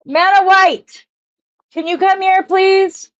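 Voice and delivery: high call across the house. Real, not acted. Vlogger calls her partner to help find necklace, high call. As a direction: sing out a name to get help. Calling out to someone.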